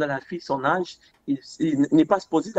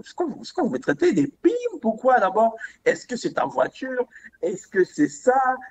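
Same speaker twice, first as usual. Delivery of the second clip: indignation rejouée; 7 semitones higher than usual. Retold or imitated. Rejoue un interrogatoire qui l'indigne.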